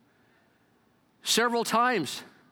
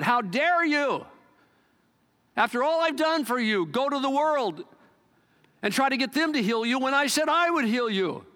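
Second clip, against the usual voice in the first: rebuking God; 6 semitones higher than usual. Retold or imitated. God rebuking the preacher for going to the world, indignant. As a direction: scold like an offended father. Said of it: "He really rebuked me".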